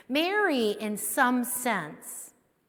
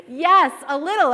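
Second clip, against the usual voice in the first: laughing; 5 semitones higher, 8 dB louder than usual. Real, not acted. Laughing at the punchline.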